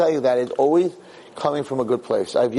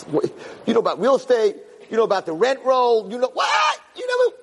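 dismissive shout; 9 semitones higher than usual. Retold or imitated. Husband dismissing his wife's doubts about the deal, shouting. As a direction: shout her down as if she can't know.

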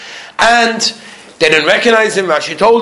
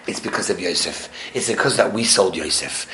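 much lower and softer; hushed realization; 10 semitones lower, 9 dB quieter than usual. Acted. Brothers explaining their fate to themselves, hushed realization. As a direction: drop right down, as if confiding the cause.

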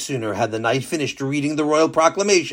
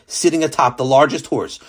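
ordinary delivery

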